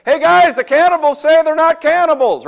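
high, loud sneering announcement; mock them to the crowd; high loud scornful jeer; mocking gossip. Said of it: "so people say".

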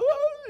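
high, loud wordless wail; panicked scream. Retold or imitated. Teenagers caught by youth pastor, panicked wail. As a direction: imitate someone too scared to speak. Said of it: "and they couldn't speak".

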